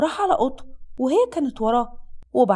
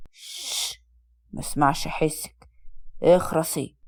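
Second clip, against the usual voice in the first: put-on low, slow drunken hushing; drunk husband shushing; 9 semitones lower, 3 dB quieter, much slower than usual. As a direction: hush her in a deep, heavy voice. Drunk husband silencing his wife.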